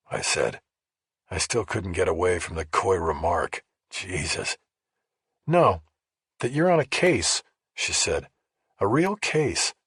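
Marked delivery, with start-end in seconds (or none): 5.5-9.7 lightly voiced woman. A narrator's reading. Rachel voiced, higher.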